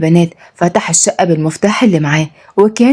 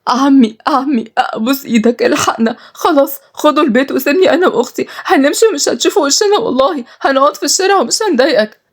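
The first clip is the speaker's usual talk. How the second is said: high, tearful begging; pleading; 7 semitones higher than usual. Acted. Malak begs her uncle for help, pleading. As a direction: beg desperately, voice pitched way up. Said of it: "تبوسها وتترجاه".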